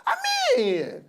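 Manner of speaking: high, loud exasperated protest; worked-up outrage. Pretend. Co-host lamenting the killings to viewers, mounting outrage. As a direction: build from pleading to provoked outburst.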